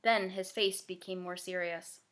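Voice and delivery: plain reading voice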